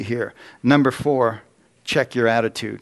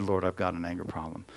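quiet confession; 5 semitones lower, 10 dB quieter than usual. Retold or imitated. Confession after scaring himself.

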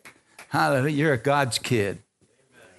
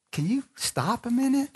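high, softer pleading ask; mock nice request; 8 semitones higher, 4 dB quieter than usual. Preacher politely asking a complainer to stop, high and soft. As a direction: pitch it up sweetly, a touch quieter.

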